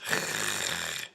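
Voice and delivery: breathy throat rasp with no voice; voiceless uvular rasp demo; unvoiced uvular fricative. Shown as a demonstration. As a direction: push air past the uvula, no voice. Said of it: "that is gonna come out like this".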